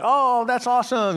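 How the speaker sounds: delighted gushing. Acted. Family watching Sophie open a gift, delighted gushing. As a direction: gush over someone's gift.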